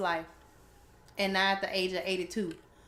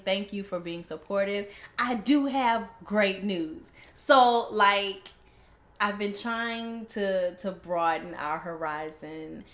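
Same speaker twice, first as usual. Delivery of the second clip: ordinary delivery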